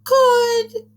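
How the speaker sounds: very high, loud princess squeal; silly princess voice. Shown as a demonstration. Teacher showing children a silly princess voice, high-pitched. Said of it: "Maybe do a princess voice this time".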